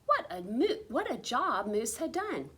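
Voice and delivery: plain reading voice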